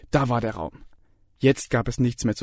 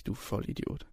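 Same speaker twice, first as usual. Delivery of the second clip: whispered private insult; thought insult; 12 dB quieter than usual. A narrator's reading. Student thinks of the lecturer, whispered. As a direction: hiss it under your breath. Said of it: "dachte sich".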